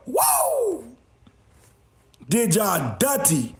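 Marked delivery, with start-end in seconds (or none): 0.1-1.0 high wrestler whoop; Ric Flair woo. Retold or imitated. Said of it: "Like Ric Flair".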